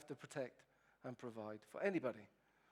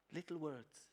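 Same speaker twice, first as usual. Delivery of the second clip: normal talking voice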